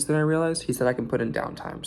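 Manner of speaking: ordinary delivery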